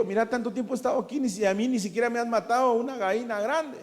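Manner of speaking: playful imitation of a jealous brother's gripe; mock indignant complaint. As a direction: huff it out like the offended older son, half laughing. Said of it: "Casi que aquí se enoja el hijo".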